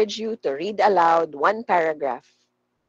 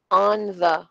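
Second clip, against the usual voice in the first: "on the" as two separate, fuller words; careful unreduced take; 4 dB louder than usual. Shown as a demonstration. Teacher contrasting forms for students, "on the" said fully. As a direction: say "on" and "the" deliberately, each in full. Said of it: "mabilis lang siya at soft lang siya".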